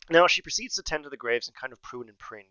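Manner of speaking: usual speaking voice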